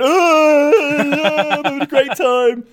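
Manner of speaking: high loud hammed-up cheer; mock fake-happy fan. Mocking losing fans putting on joy for the camera. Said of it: "they start going".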